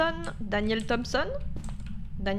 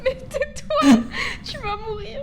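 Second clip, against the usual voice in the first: high cry through laughter; laughing panic; 12 semitones higher, 6 dB louder than usual. Real, not acted. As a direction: squeal it while laughing. Panic laughing while hiding.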